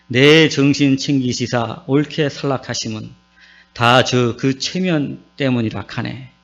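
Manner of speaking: Psalm 23 recited in Gyeongsang dialect, slowed a little; Gyeongsang dialect recital. Pretend. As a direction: recite it like a Gyeongsang grandpa, lightly. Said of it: "경상도 사람들이 이 시를 이렇게 읊습니다". (가볍게 흉내 낸 경상도 사투리 낭송)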